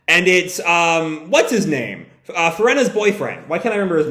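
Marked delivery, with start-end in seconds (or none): none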